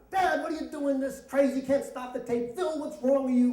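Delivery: put-on high, whining clamor of children; high-pitched mock outburst; kids acting up. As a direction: mimic kids all protesting at once. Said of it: "just start acting up".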